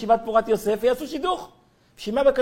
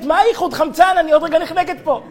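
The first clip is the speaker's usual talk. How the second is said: higher, louder panicked shout; retold shout; 5 semitones higher, 4 dB louder than usual. Girl shouting back at him in the dark, panicked shout. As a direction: yell back that you are suffocating. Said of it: "והיא צועקת".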